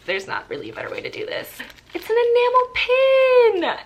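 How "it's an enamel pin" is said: high, thrilled squeal on the discovery; excited reveal. Real, not acted. Vlogger discovering a pin in the box, thrilled squeal. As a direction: squeal it out, stretched with delight.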